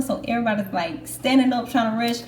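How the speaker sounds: ordinary talk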